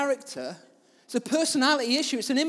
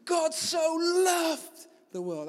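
slow, higher, drawn-out proclamation; shouting scripture; 6 semitones higher, much slower than usual. Retold or imitated. Pastor retelling his shout at a lunchtime crowd, slow and raised. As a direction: call it out slowly over the crowd. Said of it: "And I said".

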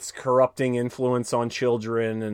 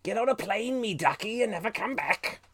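higher, hammed-up cockney-ish dismissal; playful fake British accent, pitched up; mock British voice; 9 semitones higher than usual. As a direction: shoo him off like a Londoner saying good riddance. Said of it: "They were like".

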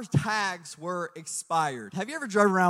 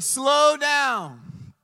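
mock urging; 5 semitones higher, 3 dB louder than usual. Husband answering sobbing wife on phone.

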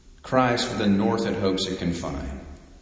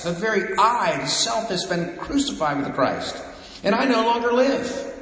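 higher, louder, driving sermon voice; fervent preaching; 7 semitones higher, 3 dB louder than usual. Acted. Preacher urging the congregation toward new life, fervent and loud. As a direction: preach it out with rising fire.

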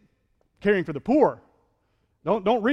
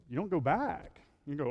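ordinary talk

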